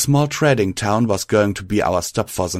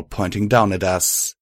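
usual speaking voice

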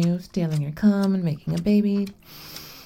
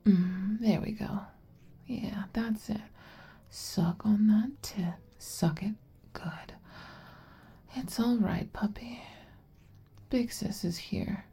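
ASMR whisper; 9 dB quieter than usual. Acted. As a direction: hush it close to the mic. Closing whispered ASMR run.

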